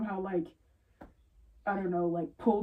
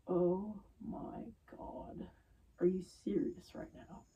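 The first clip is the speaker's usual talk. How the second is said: whisper; 7 dB quieter, slower than usual. Retold or imitated. Disbelief at customers, under breath.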